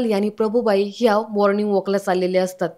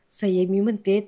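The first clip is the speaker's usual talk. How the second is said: usual speaking voice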